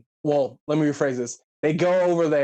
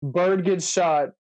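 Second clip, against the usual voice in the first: usual speaking voice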